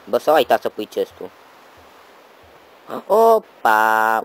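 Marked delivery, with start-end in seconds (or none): 3.1-4.2 loud, drawn-out exclamation; big loud whoop of discovery; delighted discovery. Real, not acted. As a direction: call out a triumphant find. Finds the hidden chest.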